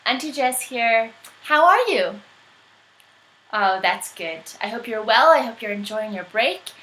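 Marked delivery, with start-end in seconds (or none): none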